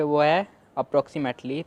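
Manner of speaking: approximately said slowly and clearly; presenting the word. Shown as a demonstration. Teacher introducing the next word slowly. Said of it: "अपने second word पे".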